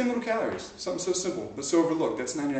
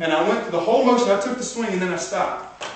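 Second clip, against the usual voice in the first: higher and louder, building hype; rising fired-up energy; pumped up; 6 semitones higher, 6 dB louder than usual. Real, not acted. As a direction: coach getting the room hyped. Pumped-up retelling of his swing.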